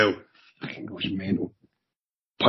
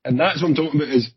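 ordinary delivery

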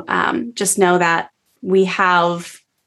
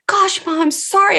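put-on higher, whiny teen apology; daughter's voice; 11 semitones higher than usual. Daughter apologizing to her triggered mom, high and whiny. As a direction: pitch up like a sulky daughter.

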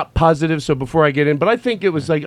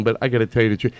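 ordinary talk, nothing marked

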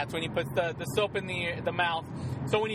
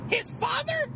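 high mock mom voice. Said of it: "He's like".

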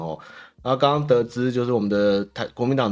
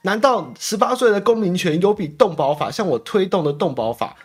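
higher, whiny mock quote of a politician; lightly voiced indignant politician, pitched up; mocking the chairman; 7 semitones higher, 3 dB louder than usual. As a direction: pitch it up to mock her complaint. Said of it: "这个朱主席被访问的时候就说".

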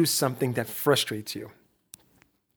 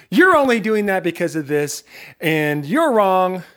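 louder, higher blaming tone, last words drawn out; mock accusation; 3 semitones higher, 5 dB louder than usual. Voicing us blaming the people we tried to help, accusing. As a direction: act out dismissing someone with raised voice. Voices what we say dismissively.

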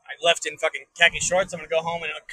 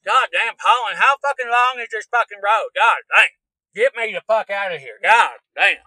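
loud, raised-pitch hillbilly griping; exasperated cursing; 5 semitones higher, 7 dB louder, slower than usual. Pretend. Driver cursing a long back road, loud exasperated outburst. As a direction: cuss out the endless road, punching the swears.

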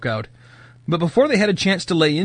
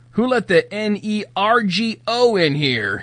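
put-on slow, higher, slurring drunk; drunken slur; 6 semitones higher than usual. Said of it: "he slurred".

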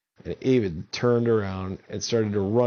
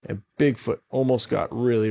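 plain reading voice

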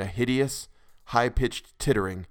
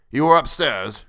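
loud, higher growled demand; 4 semitones higher, 8 dB louder than usual. A narrator's reading. Tussman questioning his pale servant, growling. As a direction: growl it at him forcefully. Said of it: "growled Tussman".

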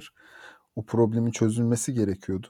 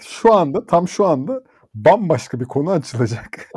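louder, excited prediction; 5 semitones higher, 6 dB louder than usual. Real, not acted. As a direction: announce it with a raised voice. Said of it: "Çünkü belli bak". (heyecanlı, yüksek sesle tahmin)

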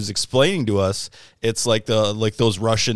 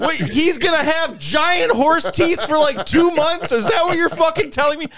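much higher and louder, slowed, hammed-up outrage; Todd freaking out; 5 dB louder than usual. Friend aghast at giant horse teeth, shrill mock panic. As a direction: squeal it out in mock horror. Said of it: "He's like".

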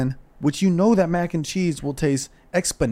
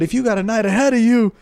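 hyped advice; 7 semitones higher, 4 dB louder than usual. Acted.

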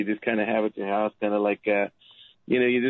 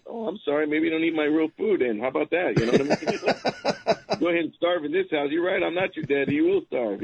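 mock sarcastic dad; 6 semitones higher than usual. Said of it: "I'm like".

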